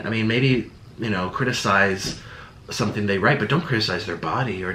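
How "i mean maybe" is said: ordinary delivery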